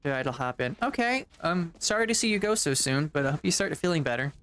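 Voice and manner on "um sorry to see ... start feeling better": ordinary delivery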